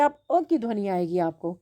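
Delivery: normal talking voice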